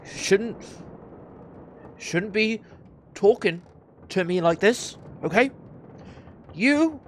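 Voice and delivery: barely contained anger, word by word; increasingly angry guard. Acted. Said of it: "just getting more and more angry".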